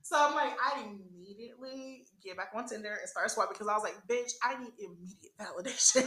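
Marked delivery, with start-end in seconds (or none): 4.1-6.1 whispered through laughter; laughing whisper. Retold or imitated. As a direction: giggle it under your breath. Retelling her thoughts, laughing.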